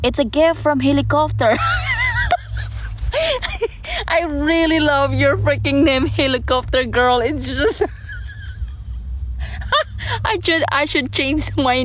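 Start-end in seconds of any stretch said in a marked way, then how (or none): 1.6-4.0 high squealing laugh. Real, not acted. Delighted by gift from viewer. 7.9-10.2 high, airless wheeze of laughter; helpless silent laugh; breathless wheezing laugh. Real, not acted. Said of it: "you making me laugh".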